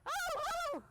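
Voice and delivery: high squealing yelps; shrieking jackpot winner. Retold or imitated. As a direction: yelp like an excited little dog. Voicing the excited horse.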